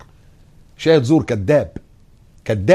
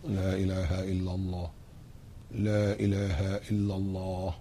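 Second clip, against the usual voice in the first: much softer and lower, slow; very quiet declaration; 4 semitones lower, 13 dB quieter, slower than usual. Shown as a demonstration. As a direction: say it under your breath with still lips. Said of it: "شفايفك ثابتة أهي". (همس خافت بلا إله إلا الله دون تحريك الشفتين)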